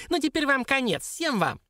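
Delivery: high, gleeful threat; squeaky triumphant dwarf; joyful squeak. A narrator's reading. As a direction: gloat in a tiny imp's squeak. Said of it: "радостно запищал карлик".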